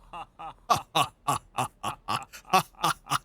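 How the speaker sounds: long staccato "ha ha ha"; drawn-out hammed-up character laugh; staged laugh. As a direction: laugh in separate, even bursts.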